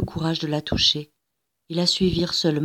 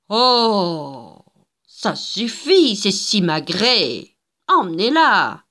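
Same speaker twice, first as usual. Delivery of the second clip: ordre méprisant; 2 semitones higher, 5 dB louder, much slower than usual. A narrator's reading. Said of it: "ordonna la dame patronnesse".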